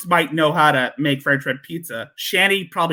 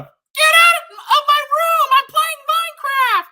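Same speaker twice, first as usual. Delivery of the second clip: put-on screaming kid meme; 4 dB louder than usual. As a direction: scream it like a furious gamer kid. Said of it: "avocado version of".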